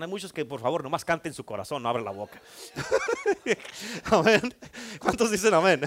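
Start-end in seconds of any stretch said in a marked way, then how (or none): 2.8-4.0 short laugh. Real, not acted. Preacher laughs at own joke.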